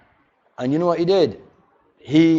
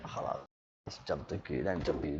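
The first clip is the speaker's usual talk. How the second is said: much softer, barely voiced letting go; near whisper; 25 dB quieter than usual. Shown as a demonstration. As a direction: let it go in a hushed breath. Letting it go calmly.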